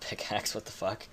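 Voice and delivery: ordinary talk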